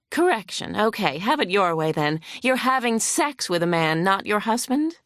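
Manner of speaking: lightly voiced hostile lawyer. Opposing lawyer grilling the vet on the stand, mildly huffy. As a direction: press the witness with mild scorn.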